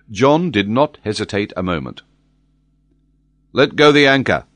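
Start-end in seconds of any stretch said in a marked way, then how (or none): 3.5-4.4 captain's order. A narrator's reading. Captain orders anchor dropped.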